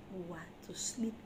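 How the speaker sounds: fading unvoiced whisper. Real, not acted. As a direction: trail off into a whisper. Closing the point softly.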